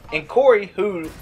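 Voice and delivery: ordinary talk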